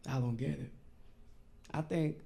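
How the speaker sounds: ordinary talk, nothing marked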